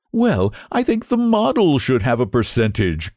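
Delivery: ordinary delivery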